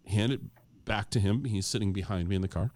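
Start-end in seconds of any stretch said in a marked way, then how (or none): none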